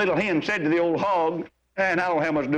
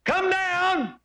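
high, sustained call; a summons pitched way up; 13 semitones higher than usual. Acted. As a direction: order someone down from a tree. Jesus calls Zacchaeus down.

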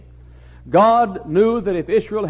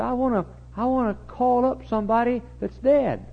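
high, hushed put-on voice; king in a high disguised voice; 8 semitones higher, 5 dB quieter than usual. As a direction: disguise yourself with a higher, softer pitch. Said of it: "he disguised his voice".

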